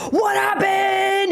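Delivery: high, alarmed shout of a panicked dad. Retold or imitated. Dad panics at daughter's bleeding hand, high alarmed shout. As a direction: cry out in shock at the blood.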